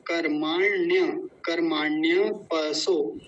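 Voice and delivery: कर्माण्य slow, with long मा and half ण; careful pronunciation demo. Shown as a demonstration. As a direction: draw out कर्माण्यपसो syllable by syllable. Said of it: "बड़े ध्यान से समझेंगे इस शब्द को".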